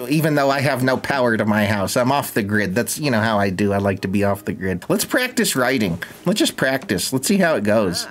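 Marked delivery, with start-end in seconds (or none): none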